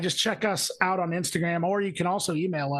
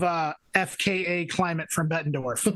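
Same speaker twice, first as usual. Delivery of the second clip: ordinary talk, nothing marked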